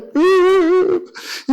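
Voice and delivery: long, high mock wail; hammed-up tearful whine; imitated crying. Husband retelling tears over dinner, imitated wail. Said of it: "밥 먹는데 울어요".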